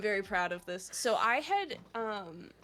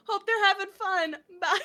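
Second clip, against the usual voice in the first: mock cheerful goodbye; 10 semitones higher, 9 dB louder than usual. Mock oblivious friend.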